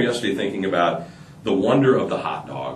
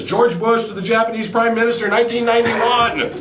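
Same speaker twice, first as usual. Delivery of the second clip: eager punchline; 11 semitones higher than usual. Acted. [name]'s punchline.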